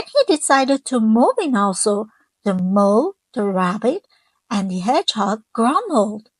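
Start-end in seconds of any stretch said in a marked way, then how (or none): none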